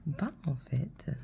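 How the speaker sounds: Ben, en fait whispered softly, almost breathless; whispered retelling. Retold or imitated. Mother mimicking daughter's story, whispered and hushed. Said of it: "elle raconte ça comme une histoire".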